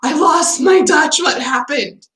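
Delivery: hammed-up alarm at a sudden loss; mock freak-out. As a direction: re-enact a moment of panic. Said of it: "I was really freaking out there".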